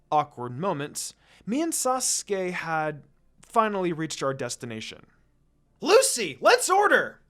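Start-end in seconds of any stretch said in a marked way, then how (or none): 5.8-7.2 loud excited call to a friend; high, loud, eager yell. A narrator's reading. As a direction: shout it across the stall, eager. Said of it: "Naruto yells".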